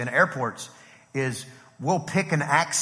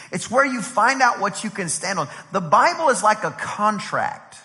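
raised, driving preacher's cadence; emphatic preaching; 6 semitones higher, 5 dB louder than usual. Real, not acted. Preacher urging congregation about the Bible's worth, emphatic preaching. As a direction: preach it in rhythmic, building repeats, pitched up. Preacher builds through repeated lines.

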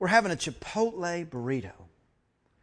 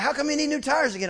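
quick, high-pitched questioning; fast mock query in a raised voice; 9 semitones higher, 3 dB louder, faster than usual. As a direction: imitate a puzzled questioner, pitched up. Voices Chris questioning him.

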